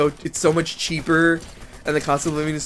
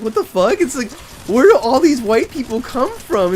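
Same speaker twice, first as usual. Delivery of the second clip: disbelief; 9 semitones higher, 6 dB louder than usual. Real, not acted. Disbelief at the runners.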